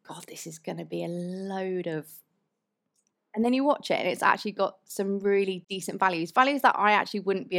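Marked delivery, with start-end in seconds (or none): 0.1-2.1 hushed, half-whispered disdain; soft, breathy aside to oneself; imagined dismissive groan. Acted. Viewer judging the film by its cover, dismissive.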